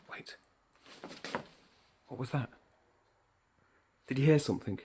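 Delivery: soft, tense, hushed question; suspenseful hush. Shown as a demonstration. Teacher showing suspense through speech, hushed and tense. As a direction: hold your breath and listen. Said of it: "I want you to create suspense through speech".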